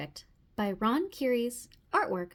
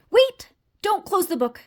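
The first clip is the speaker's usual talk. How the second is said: high, sudden cry to stop; urgent plea; 5 semitones higher, 8 dB louder than usual. Acted. Frog stops reader closing book, urgent. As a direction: break in urgently, pitched up.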